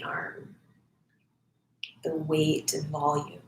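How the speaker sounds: soft, unhurried meditation voice with long pauses; guided meditation. Acted. Volunteer leads online listeners into noticing breath and body, guided meditation. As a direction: guide them gently inward, phrase by phrase, leaving silences.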